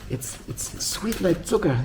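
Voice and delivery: drawn-out, syrupy imitation; mock gushing. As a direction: imitate a rabbi cooing over learning. Voices rabbis gushing about learning.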